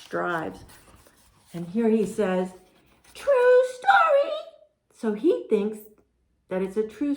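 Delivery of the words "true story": high, loud, drawn-out pigeon voice. Acted. Pigeon telling the reader his cousin tale, high squawky voice. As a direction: squawk it slowly as the eager pigeon.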